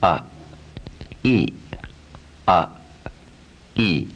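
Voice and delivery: A open, E with lips spread; exaggerated A to E. Shown as a demonstration. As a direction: exaggerate the A to E move. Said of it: "Exaggerate it a little bit".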